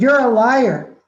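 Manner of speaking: acted-out angry shout; shouted accusation. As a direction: hurl it out as an accusation. Student repeats accusation, acted out.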